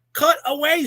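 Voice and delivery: high, loud command at the producer; loud, fed-up outburst; exasperated order. Real, not acted. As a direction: yell it at whoever runs the cameras. Ranting at the TV producer.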